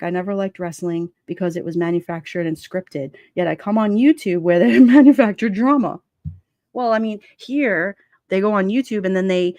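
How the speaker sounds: ordinary talk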